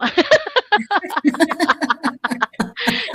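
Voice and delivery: a real, sheepish burst of laughter; embarrassed laugh. Real, not acted. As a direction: laugh it off, caught out mid-question. Host laughs at unreadable question.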